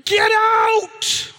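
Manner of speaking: high, strained yell of a dad at breaking point; hammed-up furious outburst, high-pitched; exasperated dad shouting. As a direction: act out losing your temper with the kids. Exasperated dad yells at interrupting kids.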